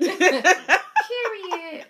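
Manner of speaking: high, loud laughing catchphrase; [name] voice. Retold or imitated. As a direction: drawl it like a Miami rapper. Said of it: "And my [name] voice".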